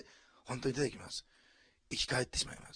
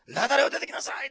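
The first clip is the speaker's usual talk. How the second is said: high, loud call to come out; a loud commanding cry; 10 semitones higher, 12 dB louder than usual. Shown as a demonstration. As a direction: shout it out as a sudden order. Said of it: "って叫んだんじゃないんですね".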